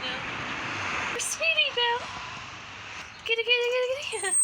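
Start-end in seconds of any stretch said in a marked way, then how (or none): 1.2-4.4 high loud sing-song call; calling a pet. Real, not acted. Calling the cat by name.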